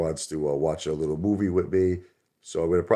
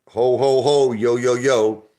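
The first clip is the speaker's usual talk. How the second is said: loud, higher movie-line callout; quoting Rocky Five; 6 semitones higher, 7 dB louder, slower than usual. Retold or imitated. Streamer quotes favorite movie line, loud and high. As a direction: belt it like Paulie's Santa. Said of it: "That was my favorite line".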